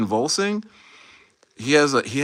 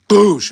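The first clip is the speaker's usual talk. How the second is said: loud high "Boosh"; sudden loud mock impact noise; mock crash sound; 7 semitones higher, 8 dB louder than usual. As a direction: blurt the crash as a sound effect. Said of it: "they're just be like".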